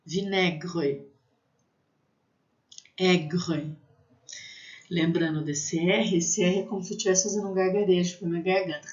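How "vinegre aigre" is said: "vinegre", pause, "Aigre", with open E and French R. Shown as a demonstration. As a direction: model French "vinegre" then "Aigre" separately. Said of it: "A, I tem som de E".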